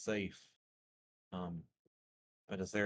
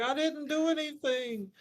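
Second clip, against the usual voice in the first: much higher, louder plea of innocence; calling out; 5 dB louder than usual. Acted. Player's character calling out her innocence while being thrown out, high and loud. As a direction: shout it back over your shoulder. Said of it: "I'm just calling out".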